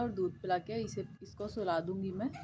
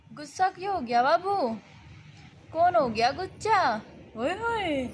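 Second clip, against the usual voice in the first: higher playful cooing; 9 semitones higher, 4 dB louder than usual. Real, not acted. Coaxing sulky toddler.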